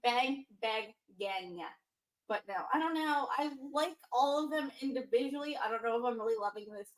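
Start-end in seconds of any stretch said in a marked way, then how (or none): 0.0-1.6 Bang Bang gang, each word stretched slow; drawn-out name. Shown as a demonstration. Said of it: "I say it".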